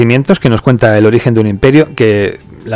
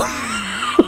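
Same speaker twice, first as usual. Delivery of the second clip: sudden high-pitched laugh; high burst of laughter; 12 dB quieter than usual. Real, not acted. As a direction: crack up at the double meaning. Risa tras "más duro. (carcajada aguda)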